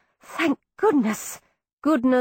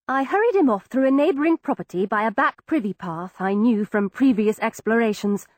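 usual speaking voice

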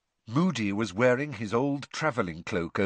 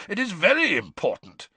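growled; 5 semitones higher, faster than usual. A narrator's reading. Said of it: "growled Moody".

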